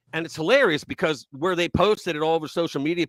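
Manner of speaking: usual speaking voice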